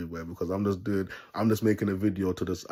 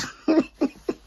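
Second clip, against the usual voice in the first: squealing high-pitched laugh; 4 dB louder than usual. Real, not acted. Vlogger cracking up at his Satan joke, high squealing laugh.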